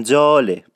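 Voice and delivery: جاله with a ج that carries a hint of ژ; Isfahani j for zh. Shown as a demonstration. Teacher repeating the Isfahani ژاله sound. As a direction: say جاله with a zh-tinged ج. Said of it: "این ج یه حالت ژ هم توش داره".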